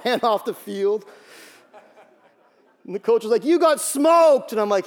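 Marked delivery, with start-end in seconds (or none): 3.4-4.5 loud, high, drawn-out mock yell; coach's holler. Coach teases player after a beating, loud drawn-out holler. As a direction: holler it slowly like a blunt coach.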